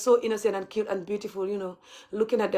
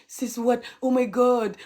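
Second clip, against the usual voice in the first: ordinary delivery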